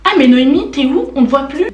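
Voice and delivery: loud, high calling out in imitation; mock classroom heckler. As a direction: call out the joke like a teasing classmate. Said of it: "quelqu'un qui va faire".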